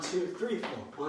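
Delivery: ordinary delivery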